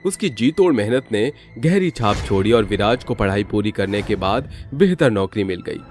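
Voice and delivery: ordinary delivery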